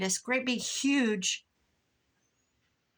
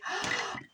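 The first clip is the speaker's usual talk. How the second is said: delighted gasp. Retold or imitated. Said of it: "I would say".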